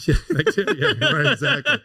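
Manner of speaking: laughing through the words, voice jumping high. Real, not acted. Guest laughing along at the host's joke, laughing. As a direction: giggle it out, amused at yourself.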